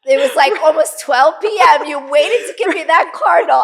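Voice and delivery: high and loud, laughing through the words; laughing disbelief. Real, not acted. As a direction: burst out laughing at the punchline. Laughing telling God off.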